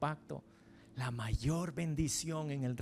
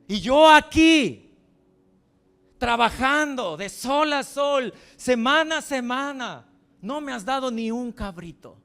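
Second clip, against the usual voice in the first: resentful complaint; 3 semitones higher, 10 dB louder than usual. Acted. The older son reproaching his father, angry. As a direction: rage at the unfairness. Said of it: "le empieza a reclamar".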